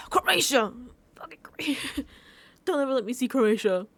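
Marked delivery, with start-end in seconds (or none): 0.1-2.0 high-pitched cursing outburst; half-joking exasperated cry; mock fury. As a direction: curse the country in exaggerated grievance.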